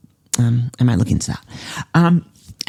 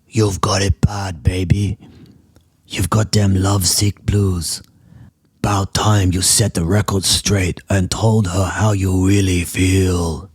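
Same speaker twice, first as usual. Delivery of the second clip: laundry monster voice; 5 semitones lower than usual. Acted. Laundry monster advising her about Amber, low crooning monster voice. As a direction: croon it like a lovesick blues monster.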